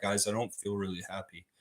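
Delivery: lightly voiced stoic OG, barely upset; emotionless mock sadness. Streamer imitates OG crying without expression, soft deadpan. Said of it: "He'd be like".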